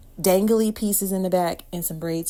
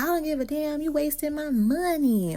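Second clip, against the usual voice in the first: mad mom; 9 semitones higher, 3 dB louder than usual. Retold or imitated. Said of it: "she's like".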